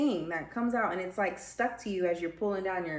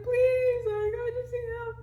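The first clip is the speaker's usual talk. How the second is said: much higher, louder sobbing plea; reenacted crying plea; 5 dB louder than usual. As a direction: beg for help through tears. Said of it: "I was crying so hard".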